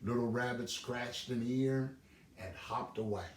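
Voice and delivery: ordinary delivery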